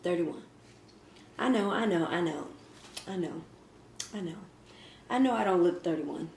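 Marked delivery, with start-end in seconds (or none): none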